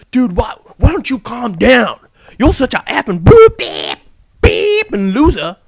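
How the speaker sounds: loud mock yell with bleeped insult; mock rant. Vlogger mocking offended gamers, loud mock yell. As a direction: shout it down at the whiners.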